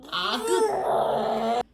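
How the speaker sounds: high, slow sing-song goodbye. Real, not acted. Saying bye-bye to baby.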